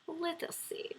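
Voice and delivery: a soft, breathy whisper to herself; whispering to herself while she looks for a question. Real, not acted. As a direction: murmur it under your breath while scanning the chat. Reading chat quietly.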